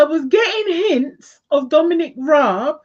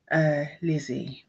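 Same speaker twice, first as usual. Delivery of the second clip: whispered name; 13 semitones lower, 11 dB quieter than usual. Real, not acted. Tarot reader dropping to a whisper to name Lizzie, hushed.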